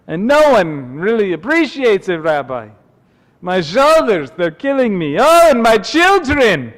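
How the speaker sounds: put-on loud, high, kvetching water carrier with an accent; Dracula-like accent. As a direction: pile up the woes in a thick accent, swooping. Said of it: "Kinda sounds like Count Dracula".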